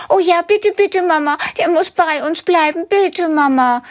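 put-on very high, pleading child; a kid begging Mama. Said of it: "sagt ein anderes Kind". (flehende hohe Kinderstimme)

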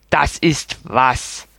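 put-on slow, sour old-man question; grumpy. A narrator's reading. Old neighbour pressing the hesitant boy, slow and grumpy. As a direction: peer over the glasses and grumble. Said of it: "hakte er griesgrämig nach".

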